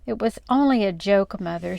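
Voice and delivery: ordinary delivery, nothing marked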